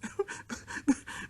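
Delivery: breathy high giggle; a light peal of laughter; child's airy laugh. Acted. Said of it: "broke into another peal of laughter".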